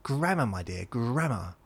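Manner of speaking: high, loud schoolmarmish reproof; condescending correction. Retold or imitated. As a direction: lecture them with "grammar" ringing out. Acted scolding over wording.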